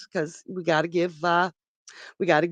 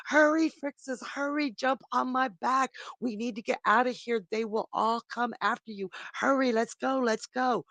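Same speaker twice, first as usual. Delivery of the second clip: higher, hushed and hurried; urgent young ram; 6 semitones higher, 4 dB quieter than usual. Acted. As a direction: urge the kids on quickly. Chrysomelos urges children to flee.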